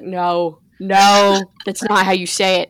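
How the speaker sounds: loud, imitated scolding teacher; hammed-up corrective outburst; mock teacher's correction. Teacher correcting students, acted out.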